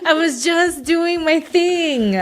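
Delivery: high, drawn-out plea of innocence; slow it right down, pitched high; playful protest. Acted. Wrestler repeating her innocence to the host, playful high protest.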